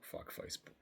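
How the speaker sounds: whispered aside; whispering. Real, not acted. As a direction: hiss it under your breath. Muttered aside.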